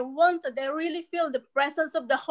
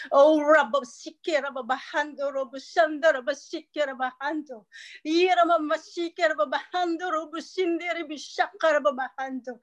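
speaking in tongues; 4 semitones higher than usual. Real, not acted. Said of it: "can I ask everybody to speak in tongues".

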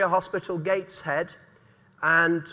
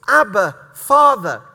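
Abba, Father drawn out slowly and louder; slow fervent cry; 9 dB louder than usual. Acted. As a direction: lean into each word of Abba, Father. Preacher slows the cry down.

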